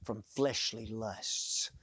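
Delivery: slow, whispered abstain from fleshly lusts; hushed and drawn-out warning. Shown as a demonstration. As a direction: whisper it slowly for weight. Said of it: "Listen to what he says".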